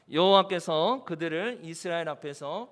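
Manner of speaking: slow, low-pitched measured verse reading; congregational scripture reading. Acted. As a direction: lead the congregation through the words in separated phrases. (낮고 느리게 함께 읽는 성경 봉독)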